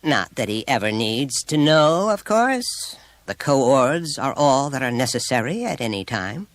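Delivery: quiet pride. A narrator's reading. Mother adding about the coords, proud. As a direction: add it proudly, as one who knows her due. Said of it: "with the quiet pride of one".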